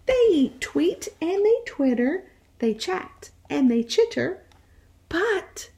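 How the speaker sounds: put-on lively read-aloud voice for young children; animated picture-book reading; storytime narration. A narrator's reading.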